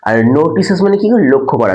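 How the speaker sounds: ordinary delivery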